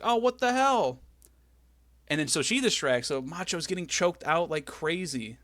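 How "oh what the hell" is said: mock shock. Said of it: "Liz is like".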